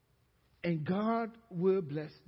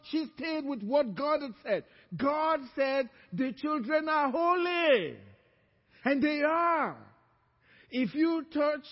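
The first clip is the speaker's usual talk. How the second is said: louder, emphatic pulpit delivery; preaching; 8 semitones higher, 6 dB louder than usual. Acted. Pastor telling mothers to trust what God said, emphatic preaching. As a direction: press the point on the mothers. Pastor repeats line with force.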